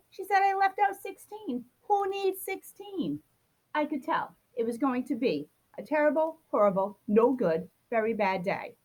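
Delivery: plain reading voice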